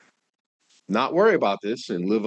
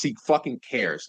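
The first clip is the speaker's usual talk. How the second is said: usual speaking voice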